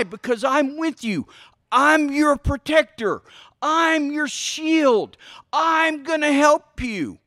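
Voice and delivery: high, slow, drawn-out comforting promises; protective reassurance. Pretend. Parent promising a scared child protection, acted reassurance.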